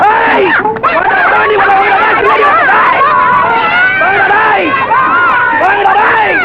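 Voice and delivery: rowdy daring the hero to fight; fight challenge. Acted. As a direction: dare him to come at you.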